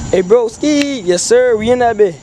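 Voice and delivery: ordinary talk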